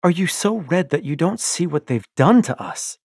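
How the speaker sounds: loud, high, accusing cry; furious wife confronting her husband; angry outburst. Acted. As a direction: throw it at him in fury.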